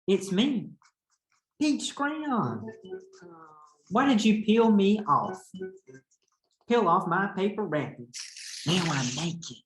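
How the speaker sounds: a lightly voiced embarrassed crayon pleading; embarrassed peach crayon. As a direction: play a mortified crayon begging for help. Teacher reads peach crayon's letter.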